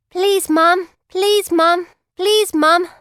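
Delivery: high, loud whining beggar's plea; begging plea. Acted. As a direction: wheedle for a penny like a street beggar. Beggar girl pleading for pennies.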